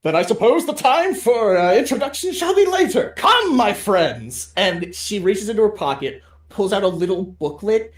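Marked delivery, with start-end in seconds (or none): 0.0-4.5 loud, high, theatrical knightly speech; rally the party like a storybook knight; grand knight. Acted. Quixote rallying the party, loud theatrical knight. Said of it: "and go".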